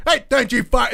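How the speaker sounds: high, loud imitated telling-off; mock indignant yell. Imitating Billie snapping at Sasha, mock angry yell.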